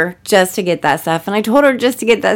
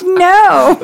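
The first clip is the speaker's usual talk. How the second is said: flat loud no; 10 semitones higher, 6 dB louder than usual. Retold or imitated. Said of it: "And he's like".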